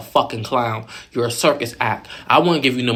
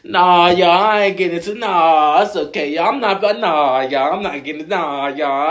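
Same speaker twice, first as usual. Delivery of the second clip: high, whiny brush-off imitation; a hint of a mocking singsong; mocking Kenzie's excuses; 5 semitones higher, 3 dB louder than usual. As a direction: lightly mimic a dodging excuse. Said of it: "Anybody else just giving".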